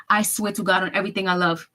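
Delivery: normal talking voice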